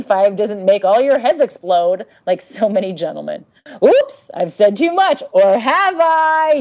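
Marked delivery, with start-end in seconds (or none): 3.8-6.5 mock ominous tease. Teasing spoiler in read letter.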